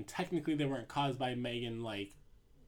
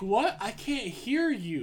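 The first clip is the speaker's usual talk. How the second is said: mildly hammed-up tipsy shout back, higher and louder; oblivious drunk man; 6 semitones higher, 5 dB louder, slower than usual. Wine-drunk Daniel answers Sarah's warning, oblivious shout. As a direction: call back drawn-out, not catching a word. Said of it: "He's too wine drunk".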